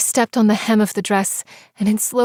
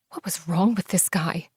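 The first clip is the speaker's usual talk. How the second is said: ordinary delivery, nothing marked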